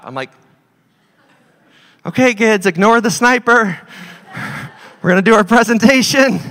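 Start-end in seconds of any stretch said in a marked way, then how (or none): 2.0-6.4 put-on raised, higher voice rallying the kids; playfully loud teacher call; mock announcing to the kids. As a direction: call out cheerfully over the danger. Retold telling kids to ignore sniper.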